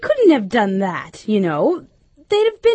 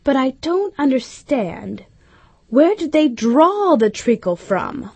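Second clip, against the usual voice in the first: higher, careful question, slowing; cautious Alice; 4 semitones higher than usual. A narrator's reading. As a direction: ask carefully, not wanting to offend. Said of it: "she began very cautiously".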